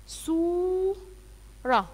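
put-on sing-song "سورة", long and high for the children; drawn-out teaching word. Shown as a demonstration. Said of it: "صاد ضمة سو". (نطق "سورة" ممدودًا وعاليًا)

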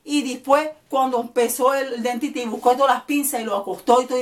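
ordinary talk, nothing marked

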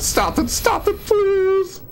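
very high frightened squeal; panicked begging. Real, not acted. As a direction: beg desperately at a squealing pitch. Begging the game to stop.

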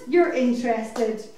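ordinary talk, nothing marked

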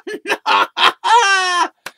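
loud high burst of laughter. Real, not acted. Losing the thought again, loud laughing burst. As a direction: let out a big shrieking laugh. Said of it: "train of thought again".

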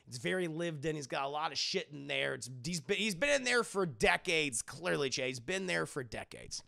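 normal talking voice

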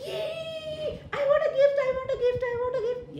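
high squealing yay, laughing; excited child. Acted. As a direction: bounce with glee, high and loud. Child begging excitedly for gift.

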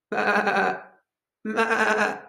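high, quavering sheep's baa; sheep bleat. Acted. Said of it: "which animal goes ba".

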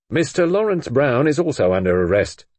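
loud, firm police statement; official announcement. A narrator's reading. Inspector telling Brenda of Lawrence's arrest, firm and official. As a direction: announce it formally, with authority.

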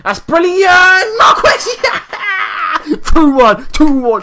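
screaming, laughing, high and loud; real jubilant shouting with a laugh; goal celebration. Real, not acted. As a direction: roar at the goal.

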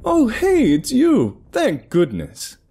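high, eager put-on voice; overly friendly disguise voice; fake voice. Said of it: "keep a fake walk and voice going".

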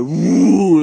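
loud high mocking whoop; mock woo. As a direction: whoop like a show-off driver. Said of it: "and they go".

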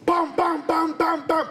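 high, punchy bum bum gunshot noises; imitated gunshots. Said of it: "I just go outside and be like".

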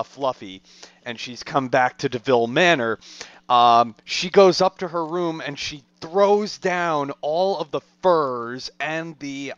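ordinary delivery

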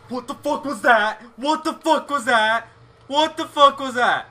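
shocked loud outburst; startled. Real, not acted. Startled by a noise in game.

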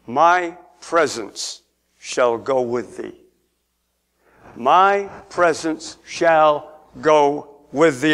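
low, very slow, measured words; God speaking. Acted. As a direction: give each word of God solemn weight. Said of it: "God said to Moses".

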